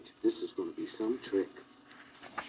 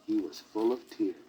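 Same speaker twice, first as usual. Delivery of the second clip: usual speaking voice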